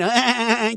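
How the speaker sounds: high mock laugh; imitated laughing. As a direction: mimic someone's mocking laughter. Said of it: "you're laughing".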